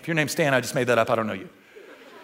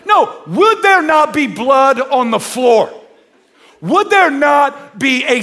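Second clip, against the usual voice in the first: emphatic preaching; 6 semitones higher, 4 dB louder, slower than usual. Real, not acted. Preacher insisting on violence.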